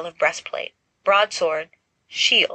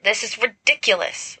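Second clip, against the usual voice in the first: snapping; 4 semitones higher than usual. Acted. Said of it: "Lily snaps".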